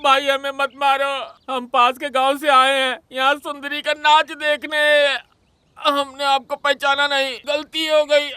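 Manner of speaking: high-pitched begging for mercy; acted terrified plea; frightened pleading. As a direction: plead not to be beaten, voice pitched right up. Beaten villagers beg for mercy.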